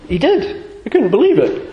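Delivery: high, incredulous, half-laughing; amused disbelief. Real, not acted. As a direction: exclaim it in laughing astonishment. Amazed at the caller's frank answer.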